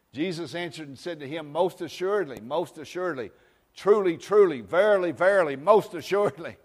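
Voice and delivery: loud, high, fervent preacher cry; full-force preaching. Acted. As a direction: proclaim it like thunder from the pulpit.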